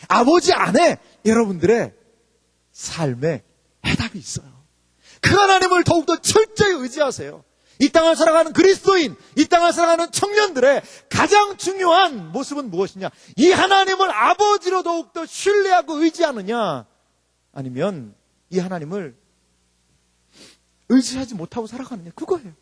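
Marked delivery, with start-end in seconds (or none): 5.2-16.9 high, shouted exhortation; full-force preaching. Real, not acted. Preacher urging young Christians to trust God, full-force exhortation. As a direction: press every phrase on the crowd.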